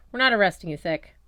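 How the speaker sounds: growled. A narrator's reading. Sunspot growls at the boy, mild growl. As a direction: grind the words out at him. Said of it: "Sunspot growled".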